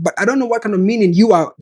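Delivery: ordinary delivery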